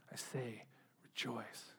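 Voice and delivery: hushed, repeated verse like a quiet prayer; soft scripture recitation. Acted. Preacher softly repeating scripture to the congregation, hushed. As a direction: let the verse fall softly, over and over.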